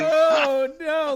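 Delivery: very high, loud mock wail; mock horror. Co-host reacting to the dog innuendo, mock horror.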